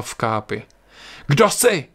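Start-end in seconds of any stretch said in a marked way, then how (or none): 1.3-1.8 shouted question. Acted. William challenging a hooded figure at night, shouted question. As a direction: shout it into the dark. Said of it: "křikl William".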